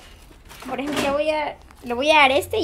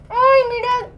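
excited cooing; 10 semitones higher, 4 dB louder than usual. Real, not acted. Cooing at the rabbit.

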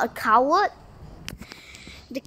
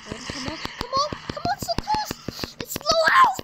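tense excitement; 11 semitones higher than usual. Real, not acted. Gamer urging a nearly beaten enemy, building tension. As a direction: urge the kill on, rising with each "come on".

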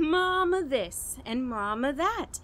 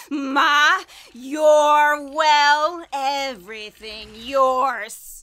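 louder, laughing cruel taunt building to a shout; mad gloating; 3 semitones higher, 9 dB louder, slower than usual. Acted. Ella taunting her drowning mother, laughing madly.

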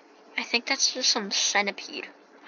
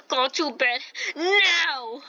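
crying dad; 6 semitones higher, slower than usual. Acted. Voicing dad in game.

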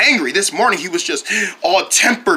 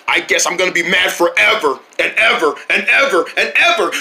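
usual speaking voice

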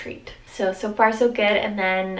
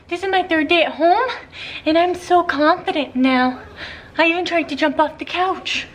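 puppy voice; 8 semitones higher, 5 dB louder than usual. Pretend. Voicing the puppy's thoughts.

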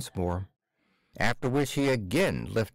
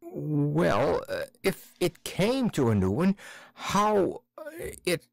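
higher, fumbling unfinished question; halting clerk; 6 semitones higher than usual. Acted. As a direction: trail off, lost for words. Akaky halting.

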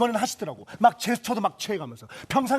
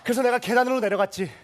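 normal talking voice

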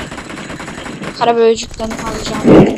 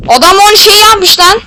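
loud, slow, thrilled yell at the match; excited shout; 8 semitones higher, 7 dB louder than usual. Real, not acted. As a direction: shout it out in disbelief. Gamer hyped mid-match.

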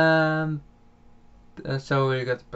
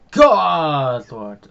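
exasperated shout; 3 semitones higher, 10 dB louder than usual. Real, not acted. Streamer whose microphone cable fell out, exasperated shout. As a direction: cry out in sudden exasperation.

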